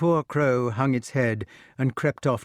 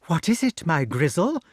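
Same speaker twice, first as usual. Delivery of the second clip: witch voice; 6 semitones higher than usual. A narrator's reading. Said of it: "she cried".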